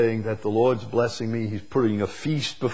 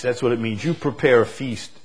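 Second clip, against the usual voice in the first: ordinary talk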